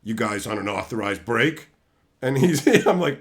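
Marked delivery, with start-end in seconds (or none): 0.0-1.7 low, soft, suspicious question; stern manager. Retold or imitated. Manager catching them on the stairs, low suspicious. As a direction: play the suspicious manager, lowered voice. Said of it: "He's like".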